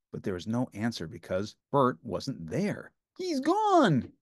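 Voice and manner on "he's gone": high puppet wail; Ernie wailing. A narrator's reading. Ernie finding Bert missing, high wail. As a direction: cry out in panic as Ernie. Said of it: "Ernie wailed".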